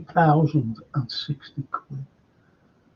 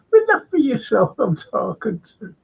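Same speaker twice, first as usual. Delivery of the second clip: high imitation Irish accent; 8 semitones higher than usual. Host repeating Irish lad's line, high Irish accent. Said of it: "I met an Irish lad".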